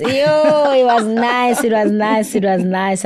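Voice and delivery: drawn-out, high delighted repeat; fading gleeful refrain; excited. Real, not acted. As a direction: savour each it was nice. Emphatic repeated exclamation.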